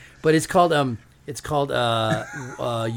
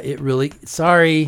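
choked up; 2 semitones higher, 8 dB louder than usual. Real, not acted. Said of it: "No crying".

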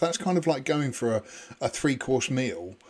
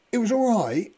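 unimpressed shrug; 9 semitones higher than usual. Retold or imitated. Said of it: "And I'm thinking".

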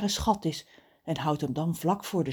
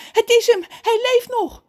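high, loud cry of relief; relieved shouting; 10 dB louder than usual. A narrator's reading. Mother learns her son lives, relieved screaming. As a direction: shout the news to the household. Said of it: "begint opgelucht te schreeuwen".

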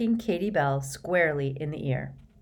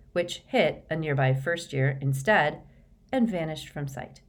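plain reading voice

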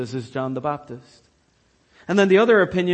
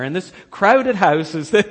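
ordinary talk, nothing marked

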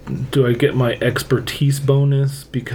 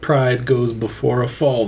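normal talking voice

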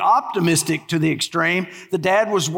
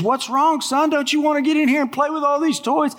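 higher, quick coaxing grown-up voice; psychologist voice; 8 semitones higher than usual. Acted. Psychologist coaxing a crying boy, higher and quick. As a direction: coax the sad little boy into the toy room.

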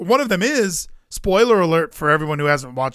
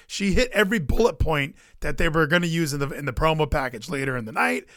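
ordinary delivery

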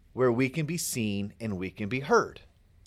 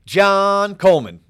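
loud, high announcer call of the name; ringmaster; 6 semitones higher, 10 dB louder than usual. Acted.

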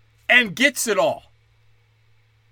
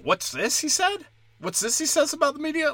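high curious voice; 8 semitones higher than usual. Retold or imitated. Comic voicing his own curiosity, high mock intrigue. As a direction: sound eagerly curious. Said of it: "be like, Oh".

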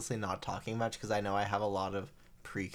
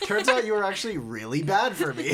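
laughing; 9 semitones higher, 5 dB louder than usual. Retold or imitated. Mock telling off toxic friend.

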